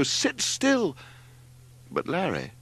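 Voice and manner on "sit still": very high-pitched screeched command; screeching. A narrator's reading. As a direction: screech it in alarm. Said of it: "screeched Margot".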